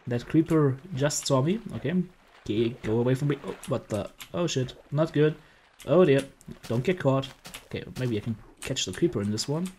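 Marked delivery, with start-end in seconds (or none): none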